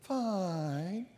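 very soft, low moping "Fine"; whiny reply. Retold or imitated. Mimicking a gloomy person, soft and whiny. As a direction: mumble it like you've given up. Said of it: "talking to folks like that".

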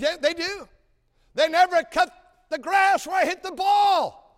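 high, loud punchline bellow; mock indignant shout. Joking to the pilot about golf, mock indignant shout. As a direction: belt out the golf joke like a grievance.

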